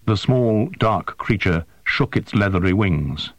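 plain reading voice

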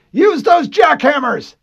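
high, loud shout of a name. A narrator's reading. Harlan shouting for Walker under fire. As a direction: yell for him across the vehicle.

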